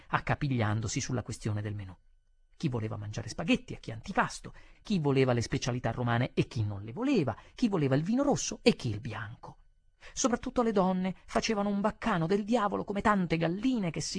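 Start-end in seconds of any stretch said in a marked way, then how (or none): none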